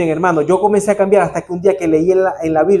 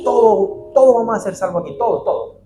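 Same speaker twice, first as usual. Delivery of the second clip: wishful reassurance; 6 semitones higher than usual. Pretend. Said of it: "A mí me gustaría decir".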